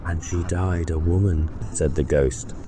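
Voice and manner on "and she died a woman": ghost's voice. Acted. Ghost speaks.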